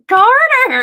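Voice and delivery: put-on high, loud reprimand of a child; scolding shout. Mother retelling scolding her cursing son, shocked shout. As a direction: re-enact a mother yelling her son's name.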